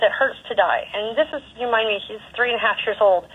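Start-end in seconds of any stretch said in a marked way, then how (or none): none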